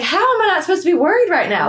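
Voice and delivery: high, loud incredulous protest; exasperated disbelief. Retold or imitated. Telling the story of the preacher's sermon on worry, exasperated and incredulous. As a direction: throw it back in disbelief. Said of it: "And I'm like".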